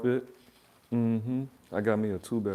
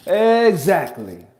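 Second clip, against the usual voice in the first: loud exclamation; 8 semitones higher, 15 dB louder, much slower than usual. Acted. Triumphant punchline.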